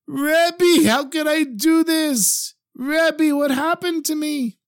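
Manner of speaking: high, slow, breaking sobbing plea. Acted. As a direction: wail it out through heavy sobs. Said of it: "breaks out into these really heavy sobs".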